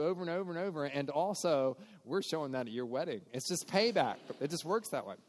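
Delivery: usual speaking voice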